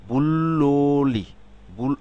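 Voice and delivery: বুল্লোলি drawn out slowly; slow demonstration. Shown as a demonstration. Teacher slowly sounding a word, then correcting herself. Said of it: "উচ্চারণ করবো তখন হবে কি".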